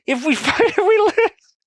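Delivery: very high, loud squeal of disbelief; laughing in despair. Real, not acted. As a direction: squeal the question through helpless laughter. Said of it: "I'm laughing, but I'm crying".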